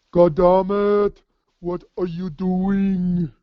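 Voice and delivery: slow, drawn-out imitation of sergeant Smoke; a lightly voiced gruff sergeant, words stretched; Smoke impression. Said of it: "was that your Smoke impression?".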